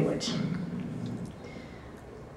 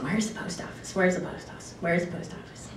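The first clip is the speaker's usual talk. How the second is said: soft, muttered practice, repeated; hushed self-rehearsal, barely voiced; rehearsing under the breath; 2 semitones lower, 13 dB quieter than usual. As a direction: run through it quietly to yourself. Rehearsing a phrase to herself.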